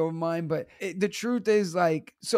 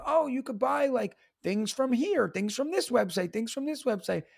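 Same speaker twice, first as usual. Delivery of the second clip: higher-pitched mimicry of helpful followers; eager tipsters; 6 semitones higher than usual. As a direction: rattle off suggestions in a higher voice. Mimics people sending shopping tips.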